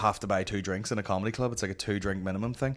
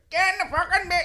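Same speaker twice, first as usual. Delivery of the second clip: high mock mammy yelling up the stairs; hammed-up Northern Irish mum shout; a Tyrone ma shouting. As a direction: bellow like a mother calling her kids. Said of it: "someone's Tyrone Ma shouting up the stairs".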